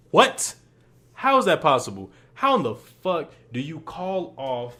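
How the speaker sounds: loud disbelieving outburst at the referee's call; outraged disbelief. Real, not acted. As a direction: yell it in disbelief at the ruling. Outraged ranting about the match.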